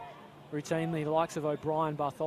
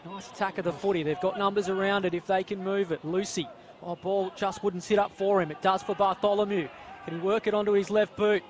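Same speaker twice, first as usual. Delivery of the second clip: urgent commentary building louder; live play-by-play; 3 semitones higher, 4 dB louder than usual. Real, not acted. Commentator calling a scramble for the ball, urgent play-by-play. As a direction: drive the call up to the whistle.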